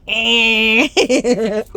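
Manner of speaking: put-on loud laughing baby "Ah"; mock toddler yell with a laugh; toddler shout. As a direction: blurt it like a toddler. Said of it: "he's like".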